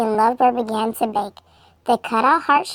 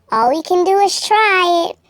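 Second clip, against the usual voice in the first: a small, high, timid little voice; high and small, gathering courage; tiny voice; 6 semitones higher, 5 dB louder than usual. Acted. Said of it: "she said in a tiny voice".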